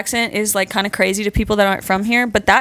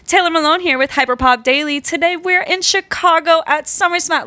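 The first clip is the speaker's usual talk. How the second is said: higher-pitched on-camera host intro; faked interview voice; 9 semitones higher than usual. Shown as a demonstration. Showing her camera voice at Summer Smash, faked presenter. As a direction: switch into a peppy presenter voice. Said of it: "I talk like this, and then I'm like".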